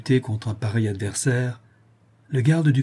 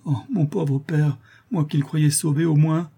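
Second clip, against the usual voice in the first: high, grief-stricken lament; sorrowful daughter's plaint; overwhelmed dejection; 5 semitones higher than usual. A narrator's reading. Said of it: "dit Louise avec accablement".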